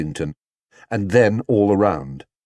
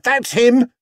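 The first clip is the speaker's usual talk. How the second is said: high, loud accusing shout; loud accusing cry; 5 dB louder than usual. A narrator's reading. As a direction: point him out with a yell. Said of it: "someone shouted".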